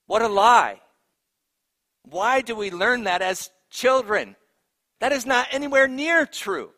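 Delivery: loud, high-pitched indignant preaching; indignant outburst. Real, not acted. Preacher debunking a childhood saying to the congregation, indignant. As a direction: raise your voice in exasperated disbelief.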